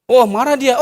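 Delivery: ordinary talk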